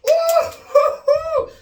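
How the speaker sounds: high loud squeal with laughter; shrieking laughing yelp. Real, not acted. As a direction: squeal through the sting. Water in other nostril.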